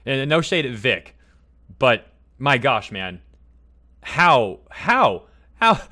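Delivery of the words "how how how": exasperated. Real, not acted. Exasperated at a former friend.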